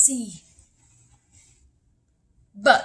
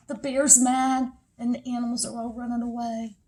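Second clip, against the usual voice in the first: ordinary delivery, nothing marked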